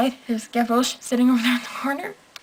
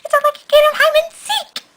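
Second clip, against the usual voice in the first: put-on much higher, louder cheeky boy's taunt; cocky kid voice; 5 dB louder than usual. Gavroche taunting the soldiers, high and loud. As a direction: play the fearless little boy showing off. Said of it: "He's like".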